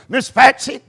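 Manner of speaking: usual speaking voice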